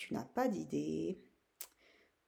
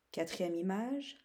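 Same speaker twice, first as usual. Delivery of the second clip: ordinary talk, nothing marked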